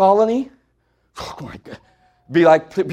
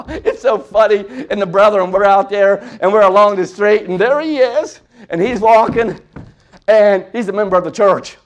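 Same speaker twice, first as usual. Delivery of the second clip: normal talking voice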